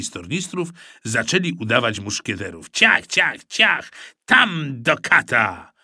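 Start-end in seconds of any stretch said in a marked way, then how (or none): 2.7-3.9 sword swish noises. Acted. Boys fencing with rulers. 4.2-5.7 slow, hammed-up swashbuckler curse; mock musketeer oath. Musketeer oath while fencing.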